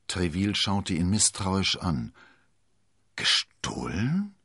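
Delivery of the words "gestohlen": slowly stretched word with a steep questioning rise; drawn out. A narrator's reading. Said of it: "wiederholte er gedehnt". (gedehnt nachgefragt)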